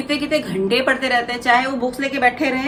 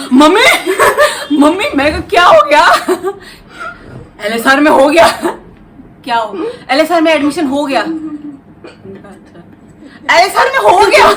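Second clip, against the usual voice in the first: much louder and higher, laughing as you call mummy; excited shouting; 5 semitones higher, 11 dB louder than usual. Retold or imitated. Mother retelling daughter shouting at night, loud and laughing. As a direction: burst in shouting for mom, then her laughing worried reply. Admission news exchange retold.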